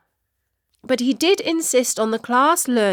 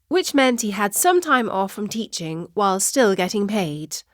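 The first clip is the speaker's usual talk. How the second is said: usual speaking voice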